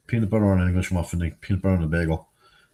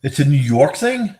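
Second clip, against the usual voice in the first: slow, high and louder disbelief; incredulous; 7 semitones higher, 6 dB louder, slower than usual. Real, not acted. Wondering to chat if it's a New York thing, incredulous. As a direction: drag it out in disbelief.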